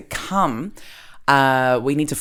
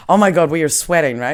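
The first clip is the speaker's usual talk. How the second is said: ordinary delivery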